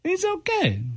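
very high-pitched approval; enthusiastic inflection. Shown as a demonstration. Host contrasting tones for listeners, high and approving. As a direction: pitch it way up, as if you like him. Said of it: "It's all about inflection, tone, right?".

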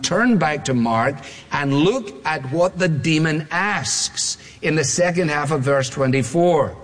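ordinary talk, nothing marked

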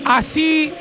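rising announcer call into the lineup; announcer build-up. Acted. Radio host hyping the home side's lineup, announcer call. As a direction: sell the team intro like a stadium announcer.

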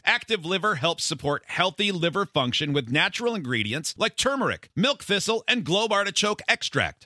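loud, high, energetic ad voice; ad read. Acted. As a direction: hype up the product.

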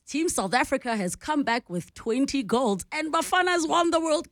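high, bright good-news anchor voice; upbeat good-news read. Shown as a demonstration. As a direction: sell it as a happy headline. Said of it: "you can hear the difference versus".

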